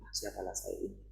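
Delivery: quiet, resigned mock self-talk; self-belittling. Preacher mimics a mother belittling herself, quietly resigned. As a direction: voice a small, self-doubting murmur. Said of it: "Jangan lagi pandang dirimu".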